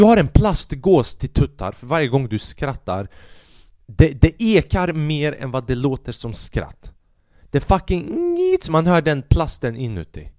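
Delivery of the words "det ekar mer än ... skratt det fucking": ordinary talk, nothing marked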